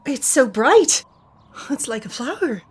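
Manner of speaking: high, breathless wonder at the colour; awed young woman. Acted. Sorsha gasps at the merchant's purple cloth, awed.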